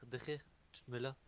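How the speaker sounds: ordinary delivery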